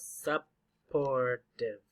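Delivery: supportive very slowly, sup... por... tive; syllable by syllable. Shown as a demonstration. Teacher shows three syllables, supportive broken apart. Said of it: "Three syllables".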